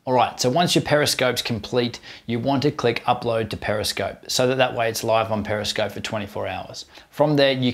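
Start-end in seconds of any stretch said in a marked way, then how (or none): none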